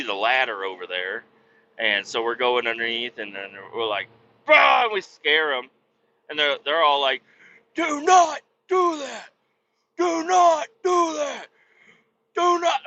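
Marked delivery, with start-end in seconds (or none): 4.5-4.8 sudden loud high "bah"; loud, high playful scare yell; scare shout. Retold or imitated. Scaring them from under the boat. 7.7-12.8 high, slow, whiny mock scream; loud, high, slow mock panic; shrill mocking shriek. Shrieking in-laws mocked.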